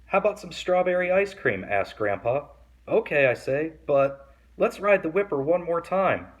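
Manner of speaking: put-on lively read-aloud for kids; story reading. A narrator's reading. Reading a picture book to kids, lively storytelling. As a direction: read the picture book to young listeners, animated.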